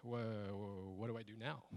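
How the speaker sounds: soft, stammering loss for words; retold bewilderment. Suddenly unsure what to do with the thief, bewildered stammer. At a loss after winning fight.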